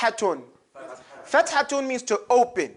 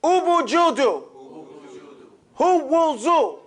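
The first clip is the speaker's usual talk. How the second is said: higher, clear chanted drill words; recitation drill; 6 semitones higher, slower than usual. Shown as a demonstration. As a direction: call each word for the class to echo. Class drill continues.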